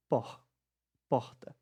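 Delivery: por, porta with the R just a puff of air; airy Nordeste R. Shown as a demonstration. Teacher showing the R is simply air, breathy h in porta. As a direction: let the R in porta go out as an h. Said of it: "é simplesmente um ar".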